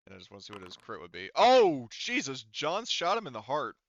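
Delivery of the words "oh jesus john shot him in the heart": ordinary talk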